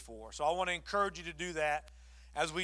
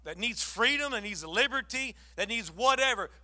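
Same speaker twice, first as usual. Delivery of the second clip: ordinary talk, nothing marked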